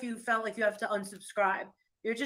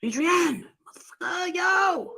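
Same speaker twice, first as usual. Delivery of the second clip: calling out; 8 semitones higher than usual. Real, not acted. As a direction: shout his name to wake him. Calling husband again.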